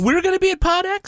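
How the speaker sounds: high, hammed-up disbelief; pretending he never heard of the conference, squeaky; mock surprise. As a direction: play dumb, squeaking in shock.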